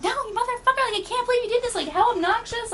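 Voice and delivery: high-pitched indignant scolding; worked-up, high mock fury; retold outrage. Acted outburst at the book thief.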